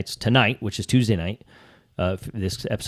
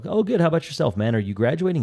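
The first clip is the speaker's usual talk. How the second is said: much higher, bright and chatty; friendly mock-cheerful reply; 7 semitones higher than usual. A man retells answering a chatty student, high and cheery. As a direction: put on a cheery elevator small-talk voice. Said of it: "I was like".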